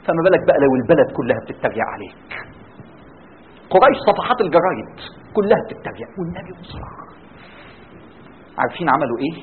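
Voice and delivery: usual speaking voice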